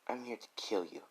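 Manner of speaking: soft, menacing threat. Acted. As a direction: say it softly, cold and menacing. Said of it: "As Rose like".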